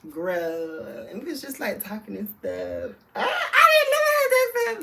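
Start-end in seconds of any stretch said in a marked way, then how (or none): 3.1-4.8 a playful, very high, loud squeal, slowed down; mock shriek. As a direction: squeal it like a joke you can't keep up. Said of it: "Let me stop playing".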